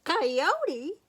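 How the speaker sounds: high, loud startled question; suddenly spot the danger; alarmed surprise. Acted. Sheepdog spotting a coyote, startled alarm.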